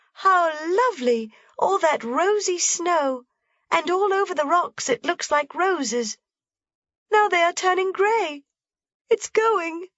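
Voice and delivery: put-on high girl's voice, wonder fading to dismay; Heidi, awed then sad. A narrator's reading. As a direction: watch the glow die away.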